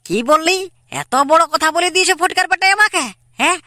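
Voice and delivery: furious "what did you say?"; outraged disbelief. Acted. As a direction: demand it back in angry shock. Son hears about the slander.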